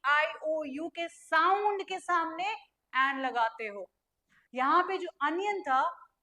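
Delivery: normal talking voice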